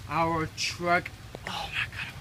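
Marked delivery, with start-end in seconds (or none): 1.5-2.1 shocked whisper, no voice. Real, not acted. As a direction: breathe it out in disbelief. Sees truck destroyed.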